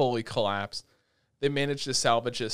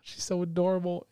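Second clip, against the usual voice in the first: gushing adoration; 5 semitones higher than usual. Retold or imitated. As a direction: coo over an adorable child. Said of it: "I'm like".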